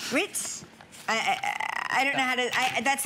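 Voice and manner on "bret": tentative New Zealand accent, pitched up; rough New Zealand accent attempt. Shown as a demonstration. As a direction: try a New Zealand accent, higher and unsure. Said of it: "Could you do a New Zealand accent?".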